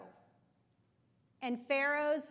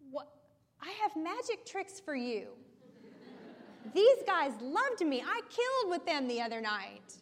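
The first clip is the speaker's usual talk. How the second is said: mocking Pharaoh; 4 semitones higher, 3 dB louder than usual. Pharaoh mocks Moses' meeting.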